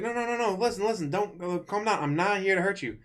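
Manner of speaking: high, rushed pleading to calm her; panicked Zuko. Retold or imitated. Zuko begs Katara to calm down, high and hurried. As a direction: talk her down in a hurry. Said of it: "He's like".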